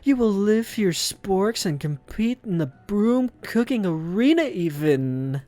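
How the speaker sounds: high, booming battle speech; Professor Dog. Acted. Professor announcing a cooking battle, rousing high-pitched oration. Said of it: "interrupts the professor's rousing speech".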